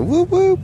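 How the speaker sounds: squeaky high falsetto whoops; demonstrating a goofy noise for the camera, high; high whoop sounds. Shown as a demonstration. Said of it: "Looks like that".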